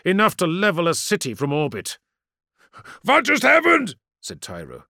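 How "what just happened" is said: loud, high, startled demand; urgent raised cry; alarmed question. Acted. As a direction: demand to know, in shock. Commander startled by sudden blasts.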